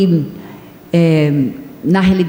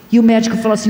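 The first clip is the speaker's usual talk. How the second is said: normal talking voice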